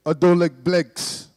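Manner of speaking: low, slow, loud menacing line. Retold or imitated. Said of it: "he went".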